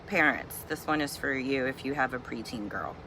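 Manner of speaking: ordinary talk, nothing marked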